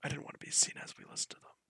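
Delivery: hushed unvoiced whisper. Real, not acted. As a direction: whisper it, keep hidden. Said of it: "Why are we whispering?".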